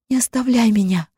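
cling to him with words; desperate plea. Acted. Malta begs Mats to stay.

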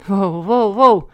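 indignant objection that builds; indignant protest. A narrator's reading. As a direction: cut in with mock outrage. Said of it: "Тут же возмутился Вадик".

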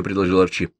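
ordinary delivery, nothing marked

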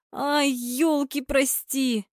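high, slow, drawn-out lament; disappointed cry. A narrator's reading. Fan seeing the ball miss, disappointed. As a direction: wail it in frustration. Fans disappointed at missed chance.

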